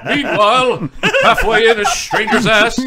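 loud superhero-show narrator parody; mock announcer. Co-host parodying scene transitions for laughs, booming announcer. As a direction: announce the scene change grandly.

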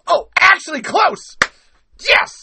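a sudden high, loud yelp of delight; thrilled at getting it right; excited. Real, not acted. Delighted at getting the impression close, high and loud.

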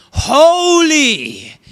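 "Holy" cried out high and long; crying out. Acted. Preacher voicing angels crying to one another, an exultant high cry of "Holy". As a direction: belt "Holy" as one drawn-out, high-pitched exclamation. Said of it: "as loud as they can".

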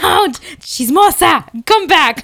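loud, high playful yell after someone; mock calling out. Listener calling after the gossipy lady, mock yell. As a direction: call her back, half laughing.

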